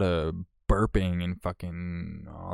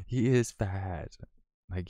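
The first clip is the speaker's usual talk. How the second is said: ordinary talk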